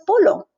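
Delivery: polo with a plain L where Y belongs; mispronounced double L. Retold or imitated. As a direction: say polo as "PO-lo", no Y sound. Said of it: "I have often heard it pronounced".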